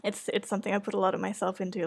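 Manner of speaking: ordinary talk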